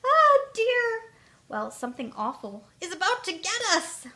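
high, loud, flustered stammering; frightened little creature raising the alarm; trembling panic. A narrator's reading. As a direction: trip over the words in fright.

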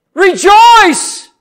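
loud, high shout; sudden pulpit cry, far above normal pitch. Shown as a demonstration. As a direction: shout it out to rouse the congregation. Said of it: "Scares you when a preacher raises his voice".

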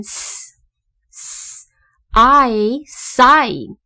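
hissed S, drawn-out I, blended into sigh; sounding out. Shown as a demonstration. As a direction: slowly join S and long I as sigh. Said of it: "所以是念成S".

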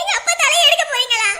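high, loud outraged shout. Acted. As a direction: yell it at the doctor in alarm. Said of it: "எதுக்குமா இப்படி கத்துறீங்க?". (டாக்டரிடம் அதிர்ச்சியுடன் கத்தல்)

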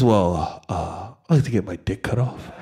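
low, soft, mumbled request; calm mumble. Retold or imitated. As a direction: mumble it sweetly, no fuss. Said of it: "since you said it so calmly".

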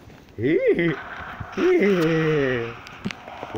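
high, loud cartoon dog giggle; Scooby-Doo laugh. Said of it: "I just do his laugh".

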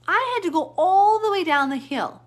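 "all" pitched very high and loud; loud, high outburst with "all" stretched; exasperated emphasis. Real, not acted. Venting about the hauling ordeal.

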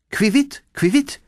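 high chirping bird call, rising. Acted. Swallow calls out from overhead, high chirp.

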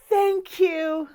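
gasping, high, slow thanks; hammed-up gratitude; overflowing gratitude. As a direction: gush with heartfelt, drawn-out thanks. Acting out grateful person for practice.

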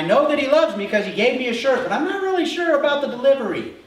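amused punchline. Real, not acted. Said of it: "punchline building up".

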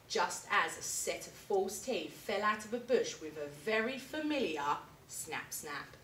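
plain reading voice

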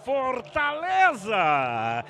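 long stretched goal cry; drawn-out goal call. Real, not acted.

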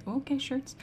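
normal talking voice

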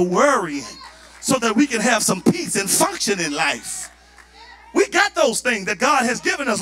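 loud, high preaching climax; full force preaching. Real, not acted. Pastor exhorting his church in a loud preaching climax.

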